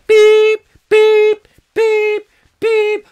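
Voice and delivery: loud, high held beeps; censor beeps. Retold or imitated. Facebook boss cutting the show off, loud high beeps. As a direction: imitate a censor tone, piercing and even. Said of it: "Mark Zuckerberg is going".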